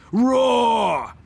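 loud, high roaring engine noise; mower roar. Retold or imitated. Imitating the motor mower.

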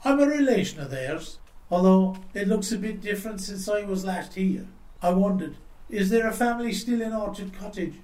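lightly voiced old traveller, gently enquiring; friendly old traveller. A narrator's reading. Old man asking after his relations, softly enquiring.

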